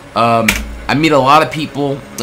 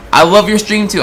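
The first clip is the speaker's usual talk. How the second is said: retold gushing reply; 7 semitones higher, 3 dB louder than usual. Acting out his gushing reply.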